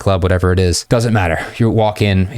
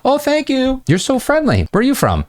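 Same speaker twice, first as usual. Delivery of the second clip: much higher, lightly gushing; bubbly mock enthusiasm. Coach acts the opener line to students, high gushing. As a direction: gush over her friendliness.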